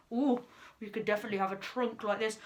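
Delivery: usual speaking voice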